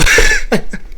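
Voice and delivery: high, loud burst of laughter; real laugh at his own outburst. Real, not acted. Man laughing at his retold comeback, loud laugh.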